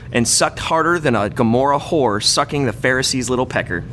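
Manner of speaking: ordinary talk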